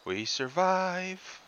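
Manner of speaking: slow, high victory cry; high drawn-out cheer. Real, not acted. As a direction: stretch it out, high and relieved. Relief after surviving the fight.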